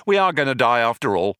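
quick, matter-of-fact, falling away; offhand confirmation. Acted. Companion admits he was fooling, offhand verdict. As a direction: toss off the grim verdict briskly. Ford drops the false hope.

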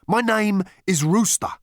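high, loud earnest introduction; earnest man. Acted. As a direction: introduce yourself proudly. Rooster introduces himself.

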